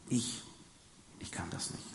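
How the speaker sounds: faltering whisper, trailing off; whispered refusal. Acted. Jeremiah telling God he cannot do it, whispered. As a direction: breathe it out, almost unable to say it.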